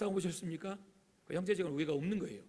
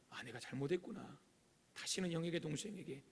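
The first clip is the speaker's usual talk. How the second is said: soft, lowered acted repentance; heartfelt remorse; 7 dB quieter than usual. Brother resolving from the heart after wrongdoing, quiet remorse. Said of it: "마음으로부터 시작하는 거예요".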